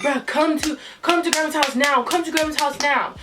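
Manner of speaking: urgent brother. Retold or imitated. Brother summoning her to grandma's house, urgent insistence. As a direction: imitate a brother ordering someone over immediately.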